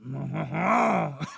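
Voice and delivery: nasal-free "haw-haw-haw" French chuckle; slow hammed-up French laugh; mock French laugh. Streamer imitating the French, exaggerated haw-haw laugh. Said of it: "That's French, isn't it?".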